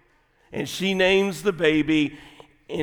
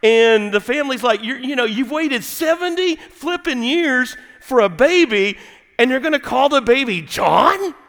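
incredulous family; 9 semitones higher, 6 dB louder than usual. Acted. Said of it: "they're like".

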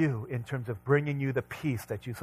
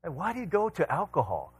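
higher, louder preacher's drumbeat of questions; preaching at full force; 8 semitones higher, 4 dB louder, faster than usual. Acted. Pastor pressing the congregation with questions, preaching hard. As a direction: hammer each question home, building. Preacher listing addictions, building.

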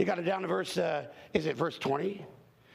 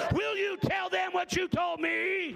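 eager plea; 11 semitones higher, slower than usual. Retold or imitated. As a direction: call it out big and slow, like a host begging a favour. Host pleads before forty-five Muslims.

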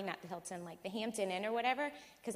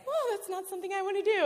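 much higher and quicker, caught off guard; startled whoa; 13 semitones higher, 5 dB louder, faster than usual. Retold or imitated. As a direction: blurt it out, startled and nervous. Startled by the bounty hunter.

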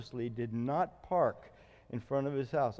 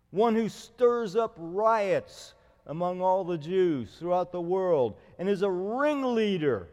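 accusing orator; 4 semitones higher, 5 dB louder than usual. Acted. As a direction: denounce Paul like a prosecutor before the governor. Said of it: "here's what he says".

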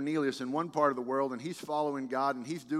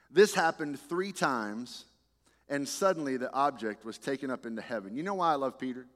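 ordinary delivery

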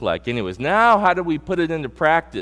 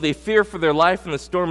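usual speaking voice

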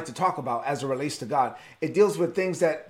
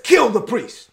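loud, higher-pitched royal command; angry king; 5 semitones higher, 6 dB louder, slower than usual. Acted. Angry king ordering his bodyguards to kill, loud command. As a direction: give the order in acted rage. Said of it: "Saul was too angry to think rationally".

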